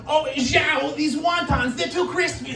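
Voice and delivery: high complaining customer voice. Pretend. Joke customer complaining to the cook, high whiny voice.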